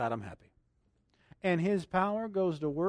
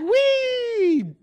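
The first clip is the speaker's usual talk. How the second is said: very high, drawn-out playful squeal; long, loud, high mock-gleeful whee; gleeful "wee"; 12 dB louder than usual. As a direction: squeal "wee" as the water balloon drops. Pretends dropping water balloons on devil.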